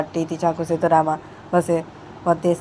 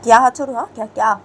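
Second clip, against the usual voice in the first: indignant retort; 5 semitones higher, 11 dB louder, faster than usual. Acted. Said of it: "तो बानी बोलती है".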